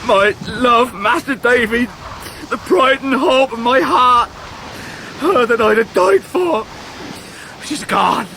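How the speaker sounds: pitched high and strained with grief; a heartbroken man barely holding together; distraught. Acted. Ham telling David that Emily has gone, distraught.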